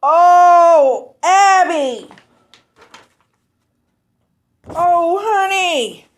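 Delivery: high, crying wail of gratitude; tearfully moved. Real, not acted. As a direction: cry out tenderly, overwhelmed by the gift. Overcome on seeing friend's drawn gift.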